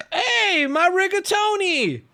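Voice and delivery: very high-pitched silly call; hammed-up high caricature of the texter; mock lovesick guy. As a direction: imitate him in a squeaky, stretched voice. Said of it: "He's just like".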